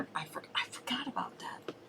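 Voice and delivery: whispered aside; whispering. Real, not acted. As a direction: drop to a breathy whisper. Embarrassed aside mid-conversation.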